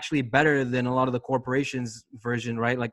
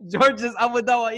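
pitched way up, teasing put-on voice; mock high voice; 8 semitones higher, 4 dB louder than usual. Co-host teasing George's nickname, in a high mock voice. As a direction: squeak the name out to tease George.

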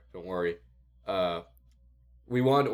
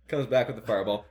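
ordinary talk

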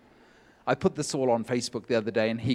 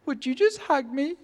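high, loud and pleading, like a big man about to break; tearful plea for a hug; 13 semitones higher, 7 dB louder than usual. Retold or imitated. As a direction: beg for the hug in a strained high voice. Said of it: "and he goes".

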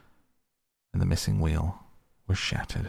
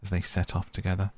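ordinary delivery

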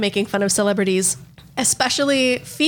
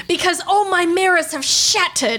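higher, hammed-up parody singer voice; 6 semitones higher than usual. Impressionist as singer laments shattered mirrors, higher parody voice. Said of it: "And he's like".

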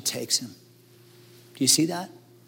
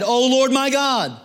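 crying out to God; 6 semitones higher, 3 dB louder, slower than usual. A narrator's reading. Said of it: "he cried unto the Lord".

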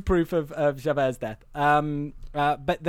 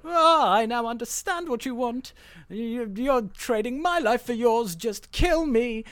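higher, theatrical realization; drawn-out, hammed-up plea in a raised pitch; Javert; 6 semitones higher than usual. Javert to the man cutting his chains, theatrical. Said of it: "And he goes".

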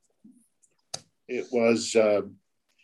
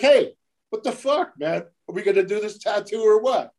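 mock yell; 8 semitones higher than usual. Retelling yelling at client.

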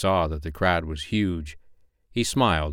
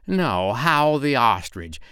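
slow, higher mocking reply; sarcastic; 4 semitones higher, slower than usual. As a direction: draw it out with heavy irony. Owl answers silly question sarcastically.

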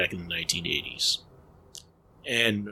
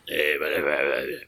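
lower-pitched imitation of a dubbed voice; mock dub voice; 6 semitones lower than usual. Film fan imitating a Giallo movie's dub, mock dub voice. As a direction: babble like a badly dubbed Giallo actor, pitched down. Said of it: "you mentioned the dub voice".

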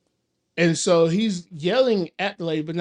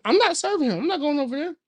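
mock refusal; 7 semitones higher than usual. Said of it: "and he's like".

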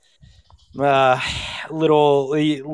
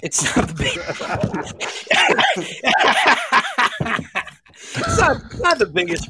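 laughing mimicry. Mocking friend's beer line, laughing.

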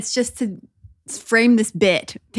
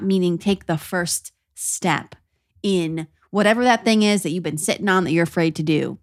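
normal talking voice

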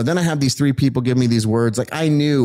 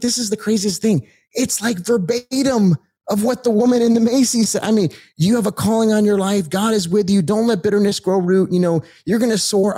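amazed; 8 semitones higher than usual. Real, not acted. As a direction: let the wonder lift the voice. Amazed the prophecies matched.

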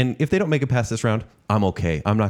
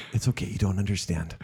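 hushed, patronizing calm; soft condescending; 7 dB quieter than usual. Retold or imitated. Host soothing his co-host about the band mix-up, softly patronizing. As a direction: talk down to him gently, barely above a murmur. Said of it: "I go".

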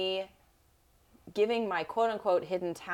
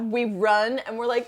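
usual speaking voice

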